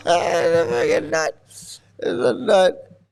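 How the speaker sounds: real laughter running through slow words; helplessly amused, talking through laughs; laughing. Real, not acted. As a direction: crack up and drag the words out. Cracking up at the video.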